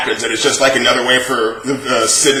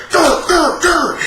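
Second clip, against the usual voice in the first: high cartoon growl; mock growling; 14 semitones higher, much slower than usual. Mocking Taiga's anger as a high silly growl. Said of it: "Ty over here".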